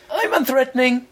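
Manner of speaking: high, sing-song unthreatening; high harmless voice. Shown as a demonstration. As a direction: sound harmless. S1 retrying unthreatening line.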